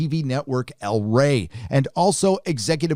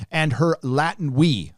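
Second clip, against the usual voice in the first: ordinary delivery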